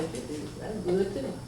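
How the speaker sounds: ordinary delivery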